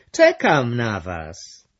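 slow, loud call back; shouting. Acted. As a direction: call out slowly so they hear. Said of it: "krzyknęła Brąba". (Brąba woła powoli i głośno do gości)

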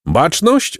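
high-pitched call to attention; storybook commander calling out, not truly shouting. A narrator's reading. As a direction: call the runners to order. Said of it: "zawołał hrabia".

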